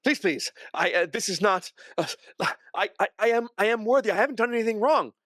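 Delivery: high, desperate stammering plea; frantic begging in a strained high voice. Acted. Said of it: "He looks at you and he begs".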